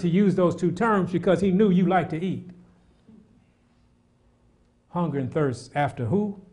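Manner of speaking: full-voiced pulpit delivery; fervent preaching. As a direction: drive the scripture home with rising force. Preacher builds on scripture.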